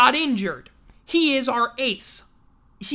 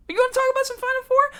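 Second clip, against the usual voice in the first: much higher, fast hyped question; hype voice; 13 semitones higher, much faster than usual. Pretend. Hyping the Final Four to listeners, high fast hype. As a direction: pump up the listeners.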